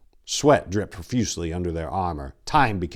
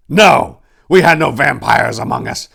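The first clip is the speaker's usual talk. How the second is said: loud, high indignant protest; loud outraged denial; forceful denial; 6 semitones higher, 12 dB louder than usual. A narrator's reading. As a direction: reject it hotly. Denies accusation forcefully.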